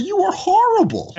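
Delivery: high loud teasing jab; teasing insult. Retold or imitated. Owner jokingly telling the customer she is horrible, high and loud. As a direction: tease her fondly. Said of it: "I said".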